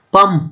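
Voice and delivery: পাম্প ending on a burst of air; demo plosive p. Shown as a demonstration. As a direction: pop the final p in পাম্প. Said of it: "ছাড়ার সাথে সাথে অনেক জোরে বের হয়ে আসছে". (পাম্প শব্দে প ফাটিয়ে বলা)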